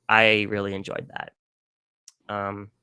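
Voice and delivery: normal talking voice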